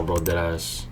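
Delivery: ordinary talk, nothing marked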